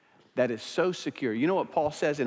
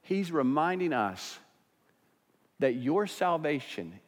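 normal talking voice

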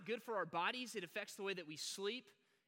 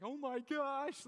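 scared sister; 6 semitones higher, 4 dB louder, much slower than usual. Retold or imitated. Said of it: "my sister's like".

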